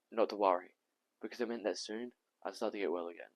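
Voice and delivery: measured recounting with heavy pauses; Tom in monologue. Acted. Tom recalling the doctor's talk about his infection, measured recounting. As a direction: recall the illness in deliberate, separate phrases.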